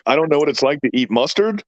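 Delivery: retold disbelief, rising at the end; incredulous echo question. Dad answering son's complaint about mustard, incredulous. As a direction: throw it back as a baffled question.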